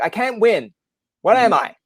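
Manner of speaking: high, loud comic exasperation; mock exasperation. Host retelling a no-win exchange with commenters, mock exasperated. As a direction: throw it out as a playful protest of defeat.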